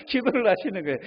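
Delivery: ordinary delivery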